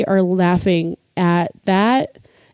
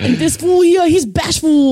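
higher, slow mock Chicano gangster voice; Latin gang accent; 8 semitones higher, 4 dB louder, slower than usual. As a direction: introduce your homie like a Latin Kings member. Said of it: "a joke about the Latin kings".

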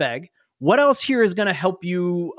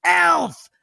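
put-on very high excited child cry; a little kid yelping in discovery; kid's shout; 12 semitones higher than usual. GM as the kid spotting the elf, high excited shout. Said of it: "the kid looks and he goes".

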